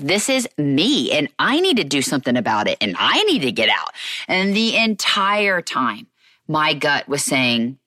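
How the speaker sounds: ordinary delivery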